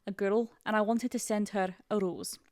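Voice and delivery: attempted Scottish reading voice; Scottish accent. Co-host reading a crush story, Scottish accent. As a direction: read it out in Scottish. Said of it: "I wanna do one in Scottish".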